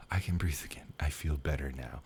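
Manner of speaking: soft, breathy sigh of relief; relieved whisper. Retold or imitated. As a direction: whisper it as the weight lifts. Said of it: "And I just was like".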